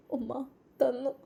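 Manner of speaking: a touch of sobbing through gratitude; sobbing softly. Acted. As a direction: fight back tears as you thank her mother. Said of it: "അവൾ വിതുമ്പിക്കൊണ്ട് പറഞ്ഞു".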